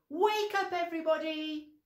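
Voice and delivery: high loud wake-up call. Shown as a demonstration. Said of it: "we have to say".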